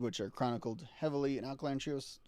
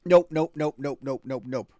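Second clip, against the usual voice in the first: loud nope; 10 dB louder than usual. Real, not acted. Reacting to a clip.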